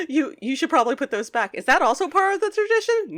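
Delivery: high, loud teasing question; imitated kid. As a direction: climb high as you quote the kid. Imitating the cartoon characters' exchange.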